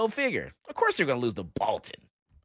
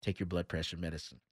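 low, much softer hushed line; retold whisper; 8 semitones lower, 12 dB quieter than usual. Coworker hushes the shouting teacher with a soft warning. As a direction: murmur it like a discreet warning. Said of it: "walked over to me and whispered".

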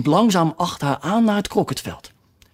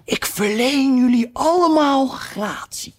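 lightly voiced king, a touch higher; king's pardon; 8 semitones higher than usual. A narrator's reading. King pardoning the whole company, a mild put-on voice. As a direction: grant the pardon mildly.

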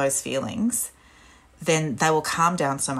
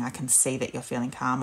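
soft, gentle parent voice; soothing validation; 4 semitones lower, 7 dB quieter than usual. Shown as a demonstration. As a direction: quietly affirm the child. Parent validating calm child.

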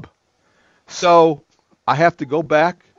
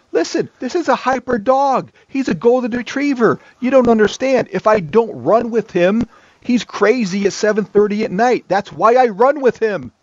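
excited, defensive; 7 semitones higher than usual. Real, not acted. Defends running with dog.

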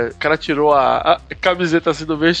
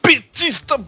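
higher, mocking reading of the comment; lightly put-on commenter voice; quoting commenter voice; 9 semitones higher than usual. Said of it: "repetindo aqui o comentário do Brasil Vermelho".

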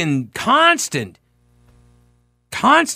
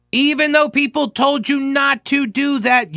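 high-pitched exasperated protest; exasperated, pitched-up scolding; indignant rebuke; 10 semitones higher than usual. Real, not acted. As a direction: scold them on a high, insistent note.

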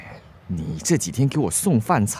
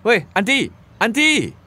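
high, loud calling her name; calling after her; 10 semitones higher, 4 dB louder than usual. Acted. Man calls after Andy as she storms off, high loud calls. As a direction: call out as she walks away.

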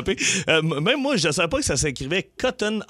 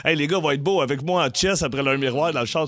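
normal talking voice

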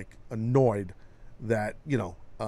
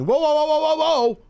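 whoa; 9 dB louder than usual. Real, not acted. Reacting to hacked comment.

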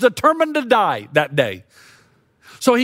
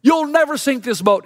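high, challenging yell; yelling at God; 6 semitones higher than usual. Retold or imitated. Lieutenant Dan on the mast daring God, yelling. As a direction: call God out to a showdown. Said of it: "And he yells".

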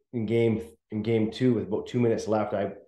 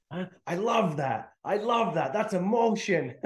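higher, slow, pleased coach praising; mock delighted coach; 8 semitones higher than usual. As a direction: gush like the coach who loved it. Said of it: "He's like".

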